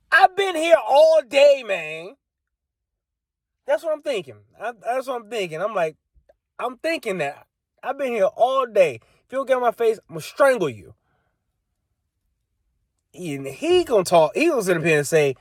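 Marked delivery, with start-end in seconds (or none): 0.1-10.9 higher, strained disbelief at being lectured; exasperated inner rage. Retold or imitated. Stuck at the airport all day, fuming at the stranger, strained high pitch. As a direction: let the frustration squeeze the voice up.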